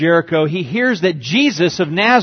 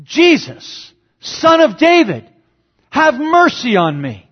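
much higher and louder pleading cry; desperate cry out; 8 semitones higher, 3 dB louder, slower than usual. Retold or imitated. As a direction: cry out for mercy over the crowd. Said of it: "he cries out".

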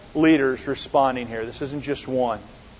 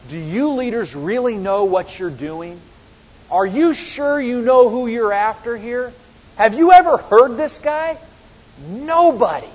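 incredulous questions climbing higher; wondering aloud; 5 semitones higher than usual. Acted. Amazed officers questioning their leaders, wondering aloud. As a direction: build from mild doubt to high, amazed disbelief. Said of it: "The officers seem to wonder aloud".

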